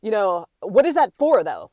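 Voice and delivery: loud imitation of an indignant man. Stranger questions a woman about her camera, indignant. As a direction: mimic him demanding an explanation. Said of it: "really getting indignant about it".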